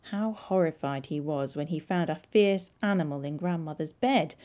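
ordinary delivery

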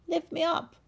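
high, bright frog call. Acted. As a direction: squeak it up. Frog calls out.

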